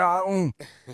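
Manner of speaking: high, worried gasp of onlookers; mock the panicking parents at the park; fretting parents. Parents at the park gasp, mock worried. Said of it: "The other parents are like".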